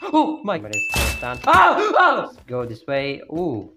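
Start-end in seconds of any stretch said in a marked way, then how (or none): 0.0-0.6 high startled gasp. Real, not acted. Scared again. 1.5-2.2 high, loud frightened yelps; scared yelps. Real, not acted. Scared again.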